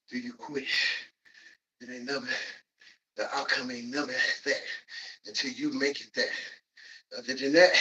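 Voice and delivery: ordinary talk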